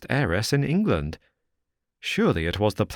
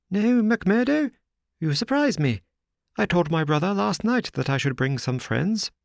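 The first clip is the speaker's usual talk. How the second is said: higher, fussy gentleman's voice; a nervous little host presenting the house; Thaddeus Sholto; 10 semitones higher than usual. A narrator's reading. Thaddeus replies.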